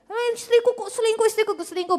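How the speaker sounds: high whiny lament; complaining husband. Acted. Husband lamenting his wife's affair. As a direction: whine like a wronged husband.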